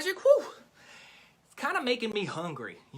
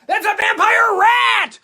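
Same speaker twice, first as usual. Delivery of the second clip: shrieked, much higher and louder, slow; hammed-up horror-movie scream; mock terror; 13 semitones higher, 13 dB louder, slower than usual. Host pretending the pet is a monster, mock shriek. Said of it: "Just kidding, just kidding".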